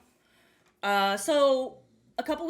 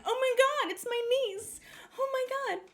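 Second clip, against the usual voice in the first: amazed delight; 11 semitones higher than usual. Retold or imitated. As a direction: re-enact gasping over a new niece. Retold reaction to seeing her niece.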